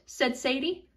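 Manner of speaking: usual speaking voice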